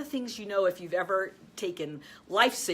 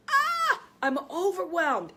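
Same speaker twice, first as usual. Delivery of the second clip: high yelped cry of panic, louder; mock overwhelmed scream; 9 semitones higher, 4 dB louder than usual. Coach showing a listener's panicked cry of overwhelm. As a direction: mimic someone panicking out loud. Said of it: "when you scream and you say you're in overwhelm".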